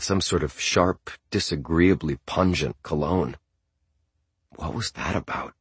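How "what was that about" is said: quiet. A narrator's reading. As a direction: ask it softly so no one hears. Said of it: "I said quietly".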